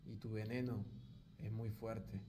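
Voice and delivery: hammed-up tender declaration to the girl on camera; mock romantic offering. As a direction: hush it like a cheesy proposal.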